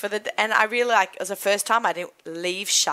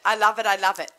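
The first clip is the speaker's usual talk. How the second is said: normal talking voice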